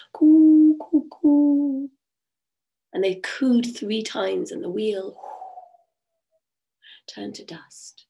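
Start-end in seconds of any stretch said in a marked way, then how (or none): 0.1-1.9 high, loud, drawn-out coos like doves; dove cooing. Acted. 7.1-8.1 soft, slow, breathy ending; hushed storyteller close; whispered reveal. A narrator's reading. Wheel dissolves, story climax.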